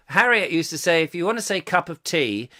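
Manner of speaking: normal talking voice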